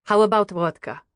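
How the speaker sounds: put-on lightly Russian-accented mother. Mother orders a drink from the waitress, lightly Russian-accented. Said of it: "Is that a Russian accent".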